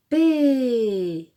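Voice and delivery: loud start on "P", stretched and falling; drawn-out syllable. Shown as a demonstration. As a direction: hold "P" as one long vowel, gliding down. Model answer in syllable game.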